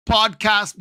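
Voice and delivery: put-on high-pitched, botched Norwegian sliding into Jamaican accent; accidental Jamaican accent. Podcaster failing at Sander's accent, sounding Jamaican. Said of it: "No, that's Jamaican".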